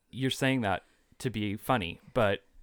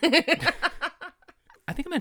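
laughing; 4 dB louder, much slower than usual. Real, not acted. Laughing while speaking.

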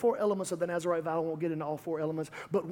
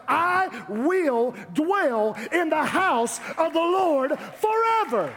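building preaching; 5 semitones higher, 4 dB louder than usual. Acted. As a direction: preach it up to a big finish. Preacher builds psalm litany to climax.

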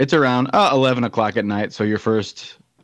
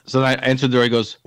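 ordinary talk, nothing marked

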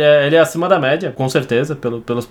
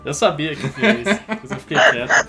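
laughing. Real, not acted. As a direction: talk through real laughter. Laughing at a bad pun. (falando rindo)

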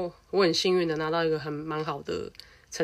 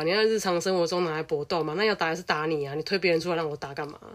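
ordinary delivery

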